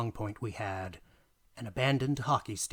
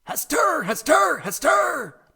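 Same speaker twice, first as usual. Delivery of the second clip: much higher, much louder repeated yell; full-throated high shout, over and over; yelling a name; 11 dB louder, slower than usual. Retold or imitated. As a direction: bellow the name across the ice rink. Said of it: "as I yelled".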